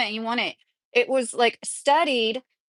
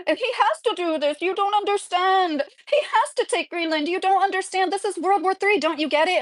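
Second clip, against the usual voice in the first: higher-pitched, hammed-up frantic insistence; mocking a commenter; 8 semitones higher than usual. Streamer mocks a know-it-all commenter's warnings, in a high, hammed-up pleading voice. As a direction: parody an alarmist commenter lecturing you, voice pitched up. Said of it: "these long multi-paragraph comments".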